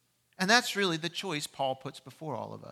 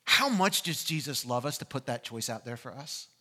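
normal talking voice

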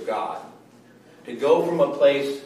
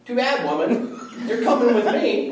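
higher and louder, laughing bossy claim; mock caveman demand; 9 semitones higher, 5 dB louder than usual. Preacher playing himself forcing his wife along, laughing hammed-up macho order. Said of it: "And I said".

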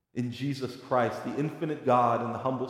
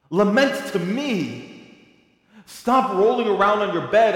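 impassioned preaching; 9 semitones higher, 6 dB louder than usual. Acted. Preacher pleading with grieving listeners, impassioned preaching. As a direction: plead with them to bring their grief.

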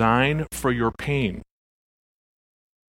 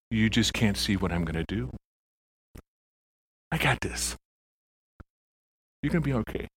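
tender; 2 semitones lower, 6 dB quieter, faster than usual. Retold or imitated. Jesus voiced reassuring the hurting.

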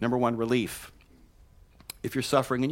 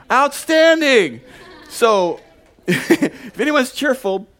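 laughing; 11 semitones higher, 11 dB louder, much slower than usual. Real, not acted.

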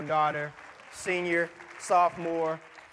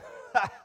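short breathy mock laugh; a quick scoffing chuckle; burglar's laugh; 5 semitones higher than usual. The burglar laughs at the parrot's name, breathy chuckle. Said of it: "The burglar starts laughing".

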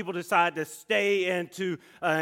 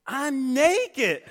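mocking shout; 8 semitones higher, 4 dB louder than usual. Scout mocking him at breakfast.

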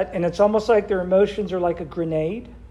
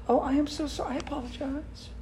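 high, softer, overdone sorry; mock gushing apology; 7 semitones higher, 9 dB quieter than usual. Pastor mimicking the offender, high and gushing.

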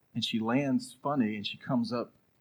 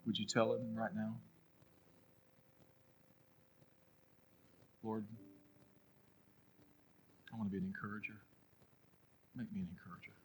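soft prayer invitation; 13 dB quieter than usual. Real, not acted. Pastor leads closing prayer.

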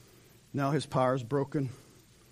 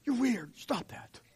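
a soft, hissed rebuke; hushed scolding; 7 semitones higher, 6 dB quieter, slower than usual. Retold or imitated. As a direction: shush them sharply under your breath. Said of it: "didn't listen to the world".

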